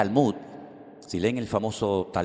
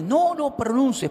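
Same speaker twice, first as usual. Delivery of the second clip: much higher, louder panicked no no no; shouting no; 5 dB louder, slower than usual. Retold or imitated. Man in the market cries out to stop the name being said, shouting. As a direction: yell it out in alarm. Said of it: "pero a gritos".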